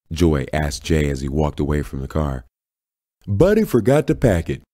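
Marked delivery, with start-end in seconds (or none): 3.2-4.6 high-pitched call back; understated exasperated yell; annoyed yell. A narrator's reading. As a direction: yell it back, only moderately loud. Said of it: "Jay yelled".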